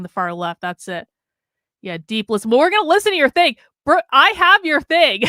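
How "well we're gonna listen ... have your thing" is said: loud, high, breaking into laughter; excited teasing. Real, not acted. Telling a friend their thing has arrived, loud and excited. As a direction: call it out high and loud, gleefully.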